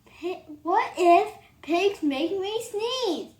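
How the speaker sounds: ordinary delivery, nothing marked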